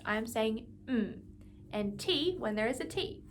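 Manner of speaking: ordinary delivery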